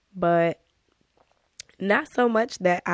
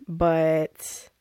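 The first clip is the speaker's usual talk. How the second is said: ordinary delivery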